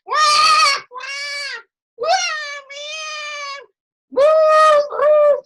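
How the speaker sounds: high, loud imitated wails and alarm whoops; screaming and alarm noise. Elevator full of crying kids and a heart monitor alarm, imitated wails. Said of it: "the noise was horrible".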